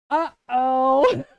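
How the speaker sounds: loud, long, exaggerated uh-oh; knowing dread. Mom who knew where it was going, ominous. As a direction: stretch it out, seeing trouble coming. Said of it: "I knew where it was going".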